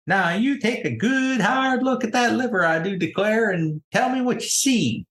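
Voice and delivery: high, swooping country-lady instruction; folksy country lady. Acted. Country lady shows pupils the boar's liver, high folksy voice. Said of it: "slaps it on our kitchen table and says".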